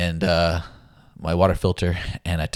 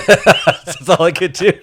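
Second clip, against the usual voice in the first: laughing it out, higher and louder; 11 semitones higher, 12 dB louder, much slower than usual. Real, not acted. Laughing to a friend about collapsing on the hike. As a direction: giggle through the words as you admit defeat.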